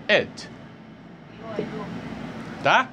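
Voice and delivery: usual speaking voice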